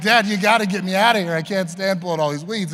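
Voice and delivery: put-on high and loud begging kid; desperate kid plea. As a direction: plead to escape the chore. Kid begging dad to stop.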